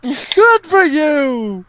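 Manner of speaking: high, slow holler; character voice. Acted. Player answering in character, high drawn-out shout. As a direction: holler it back, strained and stretched. I'm losing the voice.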